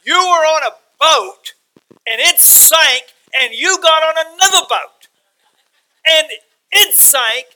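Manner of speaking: high, loud and slow, as if he can't believe it; preaching at full force, amazed; incredulous exclamation. Acted. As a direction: shout your disbelief at Paul from the pulpit. Preacher marvels at Paul's shipwrecks.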